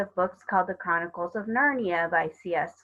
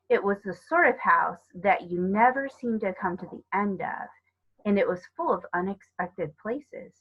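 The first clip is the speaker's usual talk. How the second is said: ordinary delivery, nothing marked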